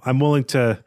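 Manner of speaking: normal talking voice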